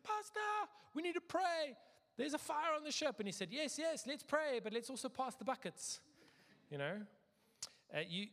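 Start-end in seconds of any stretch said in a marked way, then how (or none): 0.0-3.2 high, loud cry for the pastor; acted high-pitched alarm; panicked shouting. Buddies panic during ship fire. 3.5-6.0 high, loud, hurried-sounding rallying answer; acted urgent pastor; urgent reply. Pastor answers the alarmed buddies.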